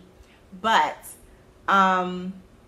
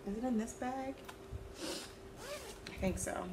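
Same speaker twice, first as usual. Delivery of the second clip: whispered aside; 6 semitones higher, 14 dB quieter than usual. Real, not acted. Rummaging in bag, muttering to self.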